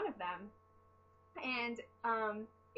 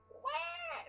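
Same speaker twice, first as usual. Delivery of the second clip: retold scream. Retelling a fright at a baby snake, high mock scream. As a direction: imitate your own startled squeal. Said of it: "embarrassing 'cause I screamed".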